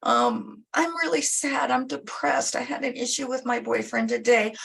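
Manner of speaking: put-on high whiny teen complaining; pouty teenager dodging cleaning her room, high and whiny. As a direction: whine like a sulky teenager who wants out of chores. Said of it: "you're telling your teenager".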